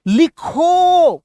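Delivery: loud, high, long drawn-out order; commanding. Shown as a demonstration. Teacher orders the person in front to write, commanding. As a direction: call it out as an order, stretching it. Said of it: "सामने वाले से".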